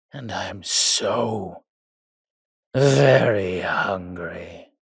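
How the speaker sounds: slow, drawn-out predatory threat; hungry menace. Acted. Vampire to approaching human hunters, hungry menace. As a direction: stretch each word, swelling loud on the hunger.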